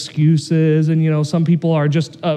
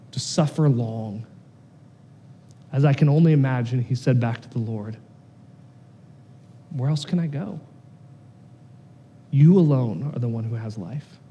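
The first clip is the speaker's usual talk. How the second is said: tender exhortation; 5 semitones lower, 4 dB quieter than usual. Acted. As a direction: gently lay it on their hearts.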